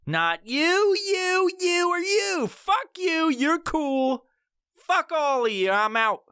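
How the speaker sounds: high mock brush-off to everyone; mock dismissive.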